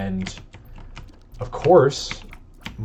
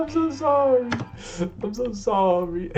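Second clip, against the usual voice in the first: much higher, stretched pleading sorry; wailing apology; 13 semitones higher, 3 dB quieter than usual. Real, not acted. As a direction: beg forgiveness in a high wail. Apologizing to viewers after game mistake.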